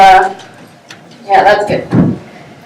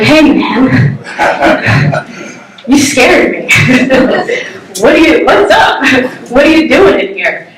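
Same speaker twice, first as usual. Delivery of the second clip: laughing through the quote; 3 semitones higher than usual. Retold or imitated. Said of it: "and I'm like".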